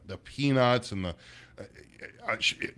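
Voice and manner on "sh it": ordinary delivery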